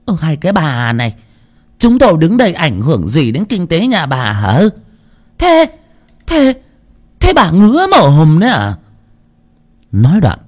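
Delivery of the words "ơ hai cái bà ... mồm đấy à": high, rising angry scold; angry yell. A narrator's reading. Bystander scolding the old woman, high angry yell. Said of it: "mà quát".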